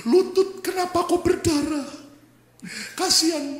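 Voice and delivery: high-pitched poor-me lament; hammed-up pitying whine; mock self-pity. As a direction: moan over your own little injury. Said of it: "Jangan begini, kasihan ya".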